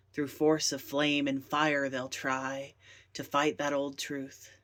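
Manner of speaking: ordinary delivery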